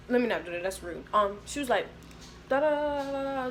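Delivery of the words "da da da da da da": higher, nonsense-syllable mimicry of foreign talk; mock babble in a raised pitch; imitated phone chatter. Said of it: "speaking Spanish or whatever".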